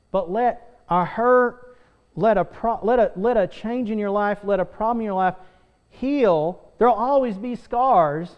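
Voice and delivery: rising preacher's fervor; fervent preaching. Acted. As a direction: drive it home to the congregation. Preaching builds louder.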